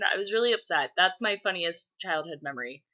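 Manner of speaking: ordinary talk, nothing marked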